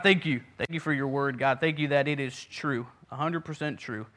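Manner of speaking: ordinary talk, nothing marked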